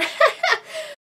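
high, short giggle. Real, not acted. Host amused by her own weird accent, giggle. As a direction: giggle at your own silliness.

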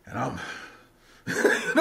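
ordinary delivery

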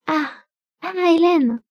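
put-on high, glad call of a girl running to her nurse; joyful cry. A narrator's reading. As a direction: shout her name with delight. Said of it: "cria-t-elle gaiement". (cri joyeux et aigu de la fillette)

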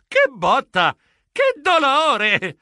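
loud wail rising high; pained exclamation. A narrator's reading. Geronimo has slipped and crashed to the floor, pained wail. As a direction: cry out, stretching the last word.

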